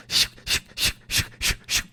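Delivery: whispered shoo shoo of a broken wheel; unvoiced scraping sound effect; wheel scraping noise. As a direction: mimic a dragging three-wheeled bag. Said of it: "have it go shoo".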